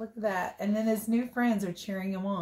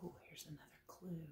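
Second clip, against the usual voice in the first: hushed excited whisper; 3 semitones lower, 23 dB quieter, faster than usual. Acted. Narrator whispering to kids about a new clue. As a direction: whisper it like a secret discovery.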